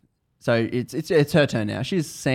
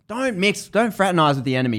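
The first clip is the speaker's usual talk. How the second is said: higher, urgent voice of a mentor; scolding mentor; 7 semitones higher than usual. Retold or imitated. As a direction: imitate a mentor hurriedly warning her off. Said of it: "It's a mentor again".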